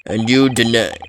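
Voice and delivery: loud, strained attempt at a Scottish burly man; Scottish accent, attempting a deep voice. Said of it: "hard to do an accent in the deep voice".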